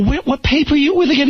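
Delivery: high, disbelieving question; mock disbelief at the photographer; incredulous. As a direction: ask as if you cannot believe it. Incredulous at thumbs-up request.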